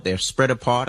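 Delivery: ordinary delivery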